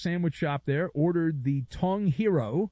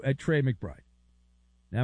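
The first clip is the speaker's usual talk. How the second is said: usual speaking voice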